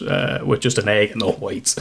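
normal talking voice

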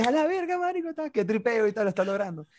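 very high, laughing hype; excited cheering. Retold or imitated. Friends at the bar cheering Chemi dancing, very high excited. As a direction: cheer your buddy on.

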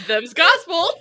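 high, loud, through laughter; laughing quote. Acted. Cohost repeats a film line, laughing loudly. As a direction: quote it while cracking up.

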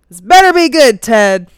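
loud, sharp, irritated wife on the phone; annoyed demand. Acted. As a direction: snap it out, fed up with him. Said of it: "the first thing out of her mouth is".

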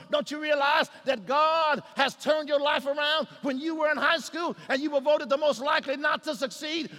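loud, high, driving pulpit delivery; fervent preacher pressing the congregation; full-force preaching. Real, not acted. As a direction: build to a shouted exhortation.

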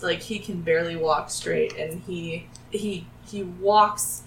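ordinary talk, nothing marked

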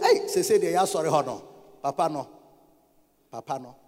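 mock low, offhand grumble; behind-the-back gossip. Members gossiping at the hairdressers about their pastor, low mock grumble. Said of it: "they will not say that".

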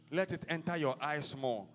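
usual speaking voice

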